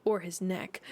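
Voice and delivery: plain reading voice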